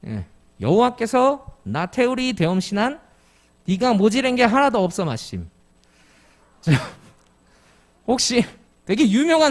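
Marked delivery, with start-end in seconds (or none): 0.6-5.5 put-on scripture read aloud in broad old Jeju dialect. Shown as a demonstration. Pastor reading a psalm to the congregation in Jeju dialect. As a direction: recite the verse the way old Jeju islanders spoke. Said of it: "제주도 말로 한번 성경 말씀을 읽어드릴게요".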